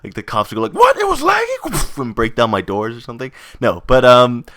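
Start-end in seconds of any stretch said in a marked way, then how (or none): 0.7-2.0 high, incredulous mock outburst; mock shocked cop. Cops reacting to lag before breaking down doors, mock shock. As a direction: play an outraged cop, voice shooting up.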